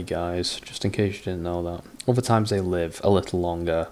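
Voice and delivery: normal talking voice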